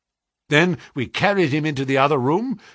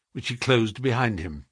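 ordinary delivery, nothing marked

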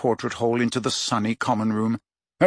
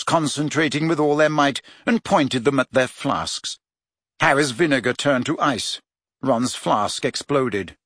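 ordinary delivery

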